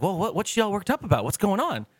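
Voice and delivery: high, fast puzzled relatives; mock confused relatives. Relatives puzzled by the fuss, high fast mock confusion. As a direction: rush the puzzled questions.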